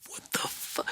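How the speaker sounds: whispered shocked curse; hushed disbelief. Retold or imitated.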